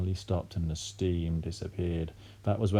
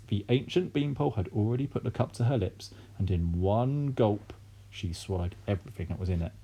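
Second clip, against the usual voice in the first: ordinary delivery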